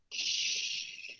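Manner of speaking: a soft unvoiced shush. Shown as a demonstration. Teacher shushing the class to show an interjection. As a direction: hush the class with a breathy shh. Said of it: "It's as quiet as I can get".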